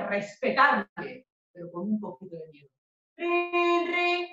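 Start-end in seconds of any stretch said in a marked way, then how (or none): 3.2-4.3 high, level ringing trill; high monotone ring-ring; phone ring imitation.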